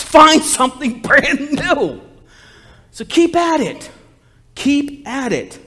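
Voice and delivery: high, loud, fired-up preacher; impassioned preaching. Real, not acted. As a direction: preach it with rising excitement. Excited preaching to congregation.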